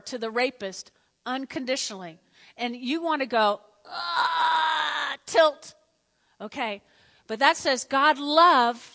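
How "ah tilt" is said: mock outraged cry. Mock outrage at loving murderers.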